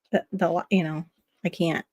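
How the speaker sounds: ordinary delivery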